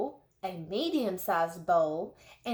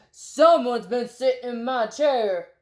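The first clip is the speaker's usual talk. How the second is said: big bear voice, louder roar; Papa Bear roaring; 2 semitones higher, 6 dB louder than usual. A narrator's reading. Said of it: "roared in his great big voice".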